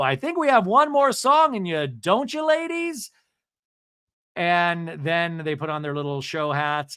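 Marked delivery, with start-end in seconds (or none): 0.0-3.1 high, loud announcer-style call; put-on voice rising at the end; [name]. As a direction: sing out the question to the room. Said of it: "we hear [name]'s voice go".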